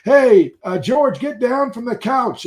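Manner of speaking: high, loud imitation of an owner; mock yell at a misbehaving pet. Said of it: "usually some lady's ju-, yelling".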